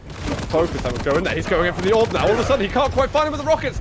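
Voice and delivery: fast, rising hype call; esports caster. Acted. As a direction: speed up with the action. Live play-by-play.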